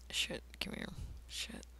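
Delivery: whispering. Real, not acted. Muttering to himself while drawing.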